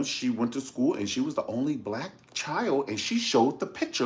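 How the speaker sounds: usual speaking voice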